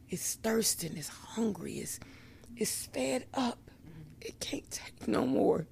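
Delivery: soft unvoiced whisper; soul whispering. Describing her soul starving for God, a soft, hushed whisper. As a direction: breathe it out as a desperate secret. Her soul's deep longing.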